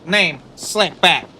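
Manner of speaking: sassy pimp correcting someone on his name; Katt Williams pimp voice. Retold or imitated. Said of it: "one of the greatest characters Cat Williams ever did".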